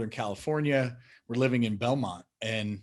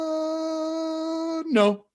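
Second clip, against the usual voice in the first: mock dismay. Father imitating his son's playful long oh no. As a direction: stretch it like a game. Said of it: "So I'd go".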